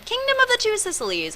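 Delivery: sung out high, relishing Kingdom of the Two Sicilies; high, delighted, savouring the grand name; savoring the name. Shown as a demonstration. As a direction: lift the name way up like it's delicious. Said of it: "Isn't that a beautiful name?".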